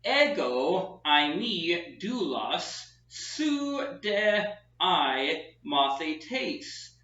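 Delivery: "ego eimi doulos su de I mathetes" read word by word very slowly; careful Koine Greek pronunciation. Shown as a demonstration. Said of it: "I have".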